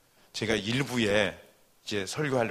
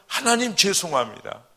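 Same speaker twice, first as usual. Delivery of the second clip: tearful repentance; 8 semitones higher, 7 dB louder than usual. Retold or imitated. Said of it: "눈물, 콧물 쏟으면서".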